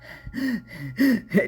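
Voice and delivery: quick, ragged gasps of panic; panicked gasping. Retold or imitated. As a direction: gasp for air as if hyperventilating. Reenacts panicked gasping on restroom floor.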